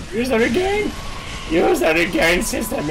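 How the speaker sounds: high, loud, cracking plea at the game; begging the game, voice breaking high. Real, not acted. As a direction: voice cracking with panic. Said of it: "Even my voice is breaking!".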